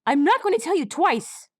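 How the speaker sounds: high, loud warning; high stern threat. A narrator's reading. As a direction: warn him sharply, up high. Colt threatens.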